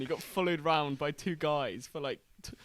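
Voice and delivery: ordinary talk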